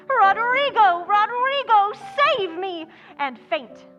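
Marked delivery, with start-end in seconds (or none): 0.1-2.9 high, loud melodramatic cry for rescue; hammed-up damsel shouting for help; demonstrated stage shout. Shown as a demonstration. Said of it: "All you have to do is shout".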